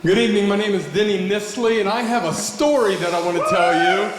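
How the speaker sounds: ordinary talk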